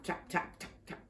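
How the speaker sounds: ordinary delivery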